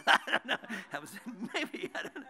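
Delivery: shrugging it off with a laugh. Real, not acted. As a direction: laugh while trailing off unsure. Laughs off his own guess.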